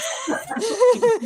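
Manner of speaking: a high, giddy laugh; short delighted laugh; happy laugh. Real, not acted. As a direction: laugh out the awkwardness of it. Laughs after greeting her mom.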